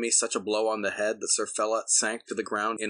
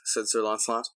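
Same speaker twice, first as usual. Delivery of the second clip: ordinary delivery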